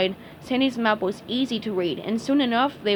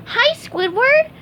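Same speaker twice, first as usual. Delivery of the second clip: very high, slow cheerful greeting; cheerful sponge voice; 6 dB louder, slower than usual. Acted. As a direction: chirp it happily.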